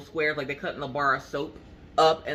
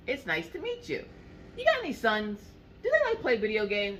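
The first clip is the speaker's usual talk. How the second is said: put-on high, eager young boy; young son asking about video games, high child voice; little boy voice; 6 semitones higher than usual.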